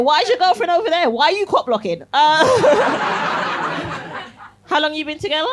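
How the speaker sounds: ordinary delivery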